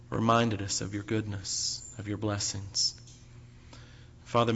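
low, reverent prayer voice. Acted. Pastor leads opening prayer.

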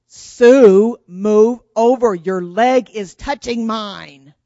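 loud, drawn-out exasperated order; acted bossy kid, every word slow and forceful; exasperated demand. Big sister to Sue in the back seat, loudly exasperated. Retold squabble, escalating last demand.

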